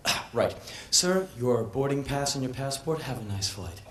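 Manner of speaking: a hint of a bored check-in clerk; lightly voiced prim clerk. Counter clerk dismissing the late passenger.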